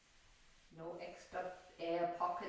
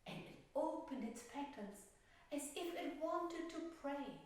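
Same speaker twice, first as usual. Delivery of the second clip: tender story narration; 7 semitones higher than usual. A narrator's reading. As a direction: let the ending glow gently. Teacher tells the violet story.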